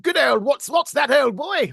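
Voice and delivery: loud, high, hammed-up old toff; over-the-top upper-class British. Shown as a demonstration. Actor recreating his all-in audition, booming upper-class British accent. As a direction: go all in on a posh British caricature, shouting. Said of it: "went all in on the old".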